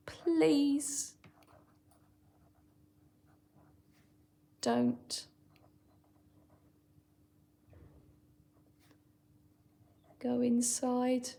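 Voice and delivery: whispered, halting plea; pleading. Shown as a demonstration. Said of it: "with quite a strong pleading voice".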